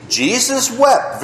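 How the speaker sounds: Jesus wept drawn out slowly, each word weighted; slow recitation. Shown as a demonstration. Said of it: "Who knows?".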